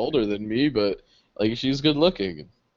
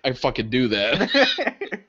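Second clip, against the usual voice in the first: slow mock-serious; much slower than usual. His eager reply quoted.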